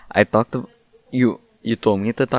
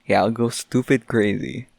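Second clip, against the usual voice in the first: normal talking voice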